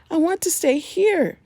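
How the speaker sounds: put-on a small boy digging in his heels; insistent child.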